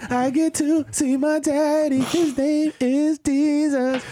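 put-on high, slow excited child; lightly voiced eager kid; little kid voice. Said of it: "Little kid just counting down the days".

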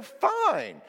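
high, loud offended retort; mock huffy. Insulted customer leaving shoe store, mock huffy. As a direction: throw it back, loud and high.

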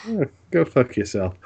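usual speaking voice

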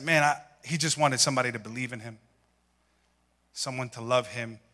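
emotional. Real, not acted. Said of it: "emotional over childhood photo".

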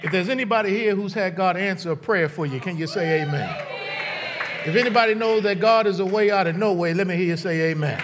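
fast, rousing call for amen; rally call. Acted. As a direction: stir the crowd up.

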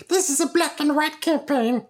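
very high critic voice; very high, snooty inner-critic impression; mocking inner voice. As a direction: join in as the nagging little voice. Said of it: "little voice".

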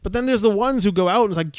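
ordinary delivery

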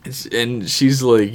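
ordinary delivery

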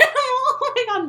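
high, drawn-out laughing exclamation; real laughter. Real, not acted. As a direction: crack up while saying it. Laughing at own funny answer.

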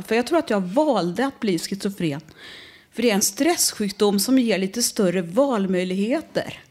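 normal talking voice

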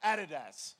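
"Adidas" with stress on the first syllable; Australian pronunciation. Shown as a demonstration. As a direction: say "Adidas" as AD-i-das. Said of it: "feels so much better when you say".

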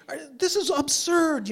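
high-pitched indignant protest. Retold or imitated. Driver firing off complaint emails, indignant. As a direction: protest it as an absurdity.

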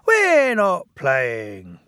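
slow, emphatic little engine; protesting. Acted. Bill protesting to Edward, slow and firm. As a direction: protest each word. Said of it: "protested Bill".

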